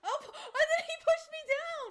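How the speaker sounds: loud, high indignant yell; shouting in disbelief at the game; outraged shout. Real, not acted. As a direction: yell it like you can't believe it. Outraged at being pushed.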